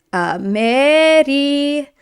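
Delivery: Amerikanca drawn out slowly syllable by syllable; teacher repeats Amerikanca slowly for class; slow model word. Shown as a demonstration. Teacher repeats the new form for learners, slowly. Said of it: "we're gonna get Amerikanca".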